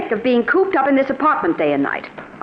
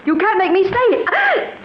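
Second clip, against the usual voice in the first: defiant; 8 semitones higher, slower than usual. Acted. Wife defies husband's orders.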